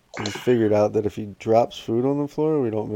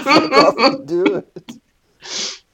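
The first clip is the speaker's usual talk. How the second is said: high, slow words through laughter; mock order cracking up; laughing exasperation; 13 semitones higher, 6 dB louder, much slower than usual. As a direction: crack up while telling him off. Father reacting, laughing.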